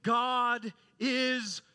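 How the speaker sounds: high, loud declaration, phrase by phrase; impassioned proclamation. Retold or imitated. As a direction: proclaim scripture to the young people with full force. Quoted professor proclaims to the class.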